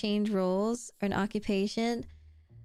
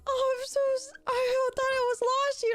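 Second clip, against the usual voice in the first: quoting Elv. Retold or imitated. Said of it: "Elv was like".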